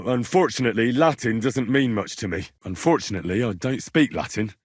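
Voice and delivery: ordinary delivery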